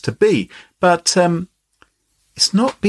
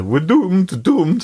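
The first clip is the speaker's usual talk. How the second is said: higher, hammed-up Scottish doom-saying; Scottish accent; 5 semitones higher, much slower than usual. Host warming up his best Scottish voice, mock-doomed Scottish accent. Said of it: "Scottish voice".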